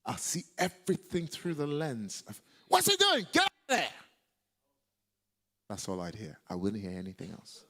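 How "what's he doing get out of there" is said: sudden high, loud yell; angry shout. Retold or imitated. A preacher relives the shout he kept hearing, angry high yell. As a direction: bellow it as an outraged order.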